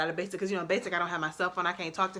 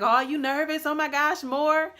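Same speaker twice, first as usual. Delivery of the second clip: teasing platoon; 8 semitones higher, 5 dB louder than usual. Retold or imitated. Platoon teasing her before marching.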